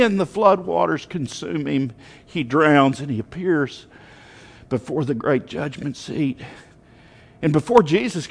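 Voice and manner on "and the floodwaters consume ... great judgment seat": ordinary delivery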